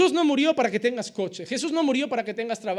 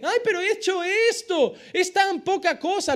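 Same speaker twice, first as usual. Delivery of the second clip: high, whining mock believer; high-pitched whiny excuse; 8 semitones higher than usual. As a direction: mimic a believer shrugging off sin. Believer minimizing small sin.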